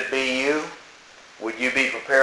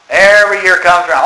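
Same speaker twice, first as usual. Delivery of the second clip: ordinary talk, nothing marked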